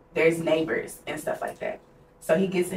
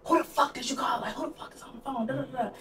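high, hushed, strained imitation of his rage; mock outraged; 8 semitones higher, 4 dB quieter, faster than usual. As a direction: act out his outburst in a high half-voice. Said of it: "like, outraged".

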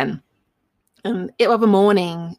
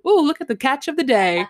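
put-on proud kids' cheer; 7 semitones higher than usual. Kids showing off snake.

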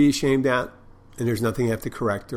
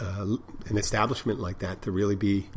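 ordinary talk

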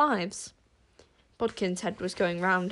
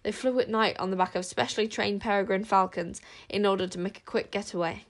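ordinary delivery

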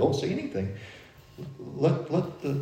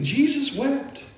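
fervent preaching; 9 semitones higher, 6 dB louder, much slower than usual. Real, not acted. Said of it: "preaching on weeping".

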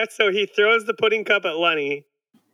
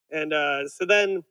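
ordinary talk

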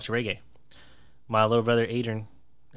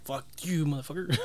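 half-hearted replay of a furious curse; retold angry cursing; 5 semitones higher than usual. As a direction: act out losing your temper. Retells his own angry outburst.

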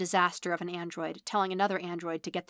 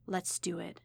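soft, hushed murmur; whispered; 7 dB quieter than usual. A narrator's reading. Android to her partner in a dark lab, whispered. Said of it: "she whispered into the room".

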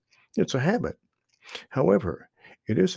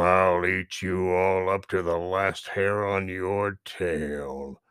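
low, slow growling bear voice; slow, menacing bear growl; 4 semitones lower, slower than usual. Acted. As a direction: threaten like a big angry bear. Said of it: "growled Buster".

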